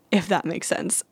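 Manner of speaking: ordinary talk, nothing marked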